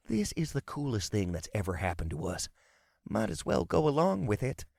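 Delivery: lightly voiced southern American; southern drawl. A narrator's reading. Wizard player out of character to hero, southern drawl. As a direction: talk it out in an easy southern accent. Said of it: "he said with a southern drawl".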